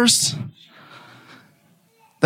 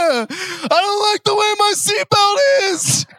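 put-on whiny child's cry, higher and slow; 12 semitones higher, slower than usual. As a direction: wail like a toddler upset by her seatbelt. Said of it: "My little Juliet".

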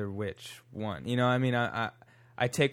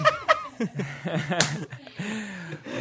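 high burst of laughter; 8 semitones higher than usual. Real, not acted. Laughs at studying poli sci.

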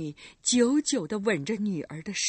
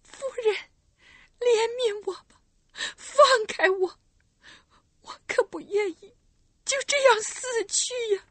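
high, crying plea for mercy; tearful pleading; 13 semitones higher, 5 dB louder than usual. Acted. Kneeling girl to the recluse, crying plea. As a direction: beg for your life through tears. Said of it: "双膝跪地说道".

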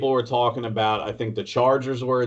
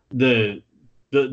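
ordinary talk, nothing marked

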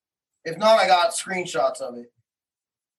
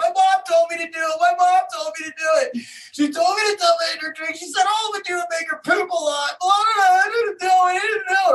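mock child screaming; 9 semitones higher than usual. Son confessing to his dad, imitated crying scream. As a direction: wail it like a scared, crying boy. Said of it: "starts screaming".

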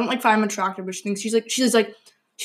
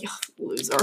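disgusted; 8 semitones lower, 10 dB quieter than usual. Retold or imitated. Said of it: "You'd be like".

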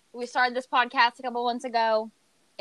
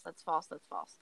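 trailing off, each repeat softer and lower; fading mutter; 6 semitones lower, 12 dB quieter than usual. Real, not acted. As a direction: let it die away under your breath.

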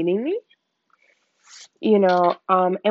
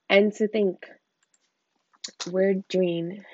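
ordinary talk, nothing marked

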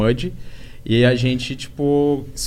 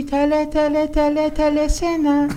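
very high squeaky anime voice; anime girl voice; 14 semitones higher than usual. Retold or imitated. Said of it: "uma voz de anime, uma voz de hentai mesmo".

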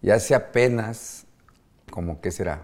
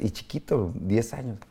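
ordinary delivery

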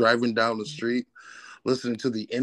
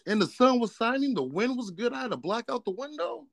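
mock dramatic; 11 semitones higher than usual. Mock dramatic car scene.

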